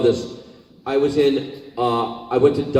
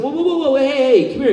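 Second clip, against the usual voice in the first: high, excited whoa calls; calling out; 4 dB louder than usual. Retold or imitated. Actor calling the boy back, high excited whoa. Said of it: "I said".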